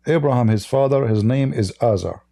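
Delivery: ordinary talk, nothing marked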